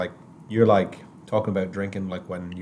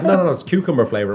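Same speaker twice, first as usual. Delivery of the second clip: eager beer pusher; 8 semitones higher, 4 dB louder, faster than usual. Retold or imitated. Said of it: "They're like".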